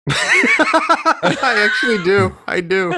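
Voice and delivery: breaking into laughter mid-sentence; laughing while admitting it; laughing through words. Real, not acted. Laughing at the joke's punchline.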